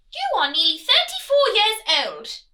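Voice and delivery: loud, high, haughty royal nagging; nagging queen. Acted. Queen nagging her son the prince, loud and high. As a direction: scold him like a bossy queen. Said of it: "the Queen had been nagging her son".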